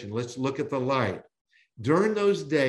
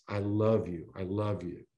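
tender voice of Jesus; 6 semitones lower, 5 dB quieter than usual. Acted. Jesus tenderly telling Saul he loves him, softly. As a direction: ease right down into a gentle whisper of love. The voice speaking to us.

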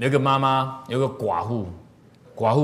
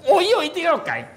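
mock vow; 4 dB louder than usual. Mocking the petty man's vow.

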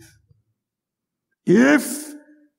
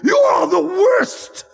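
king's mock-furious shout at the prisoner, higher and louder; feigned anger; 5 semitones higher, 7 dB louder than usual. King pretending to throw a prisoner out, mock furious shout. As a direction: thunder at him like a king pretending to rage. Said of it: "he acted as though he was angry".

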